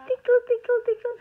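high, loud tickle singsong; playful tickling. Real, not acted. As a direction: squeal it while tickling. Tickling the baby.

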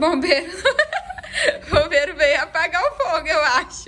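loud, amused laughter through the words; words breaking into loud laughter; laughing. Real, not acted. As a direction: laugh while poking fun at the idea. Laughing at the firefighter suggestion.

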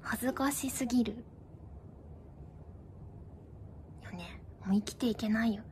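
低く小声で落ち込んだふり; 恥ずかしさに沈んだ小声; 消え入りそう. Pretend. 芋けんぴ芝居の後に恥じて嘆く. (ぼそぼそと絶望ごっこ)

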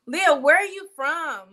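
loud, slowed question to a viewer; loud calling out. Real, not acted. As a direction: call out to her by name.

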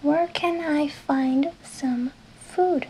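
slow, carefully spaced English question; slow teacher delivery; slow model question. Shown as a demonstration. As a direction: say each word slowly for learners. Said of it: "una forma que lo puedes preguntar".

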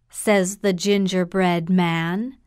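plain reading voice

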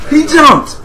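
high, loud exclamation; shouting. Real, not acted. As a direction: yell it as it happens. Opponent jumps.

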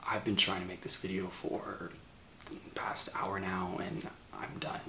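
barely above a whisper, drained and low; a worn-out near whisper, like he's about to break; hushed, tired confession. Acted. Hushed, ashamed apology to his viewers.

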